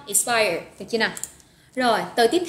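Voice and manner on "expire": "Expire" stressed as ik-SPIRE; stress on second syllable. Shown as a demonstration. Teacher repeats the word for learners, "Expire" stressed on SPIRE. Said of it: "nhấn âm số hai ha".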